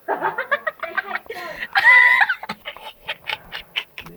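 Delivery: long shouting laugh. Real, not acted. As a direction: howl with laughter. Long laugh after the joke.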